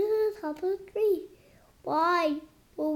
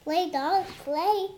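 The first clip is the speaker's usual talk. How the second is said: normal talking voice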